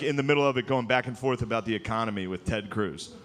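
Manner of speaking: normal talking voice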